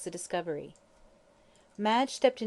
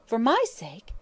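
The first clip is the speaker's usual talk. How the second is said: startled; 4 semitones higher, 4 dB louder than usual. Acted. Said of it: "she cried in a startled voice".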